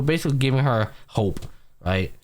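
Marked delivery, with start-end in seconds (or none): none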